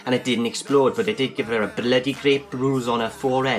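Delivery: complainer voiced in a Welsh accent. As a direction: put on a Welsh accent for the hapless customer. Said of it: "I got nothing against the Welsh".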